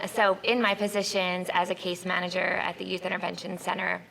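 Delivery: usual speaking voice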